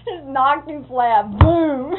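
a slow build to a loud, high "boom"; loud mock blast. Boy telling how his uncle was knocked flat, loud slow boom.

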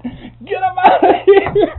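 high, slow, drawn-out shout; full yell. Retold or imitated. Bank president throws the photographers out, drawn-out yell. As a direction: yell it long and loud across the bank. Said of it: "They just yelled it out".

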